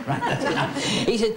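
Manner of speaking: normal talking voice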